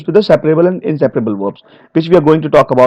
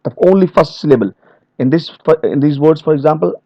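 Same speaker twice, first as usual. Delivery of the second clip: ordinary talk, nothing marked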